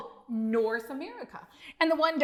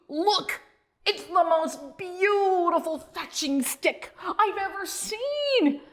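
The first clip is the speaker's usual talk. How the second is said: thrilled high dog voice; 7 semitones higher than usual. A narrator's reading. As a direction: gush over the perfect stick. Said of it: "Duke howled".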